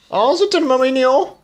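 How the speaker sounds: high cooing aww; gushing. Real, not acted. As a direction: coo over something cute. Gushing at cute scene.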